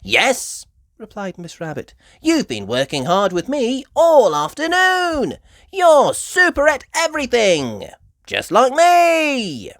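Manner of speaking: Miss Rabbit. A narrator's reading. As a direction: cheer Peppa on.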